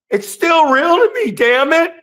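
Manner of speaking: hammed-up fan outburst; it's still real to me. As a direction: parody the desperate crying fan. Quoting famous fan line.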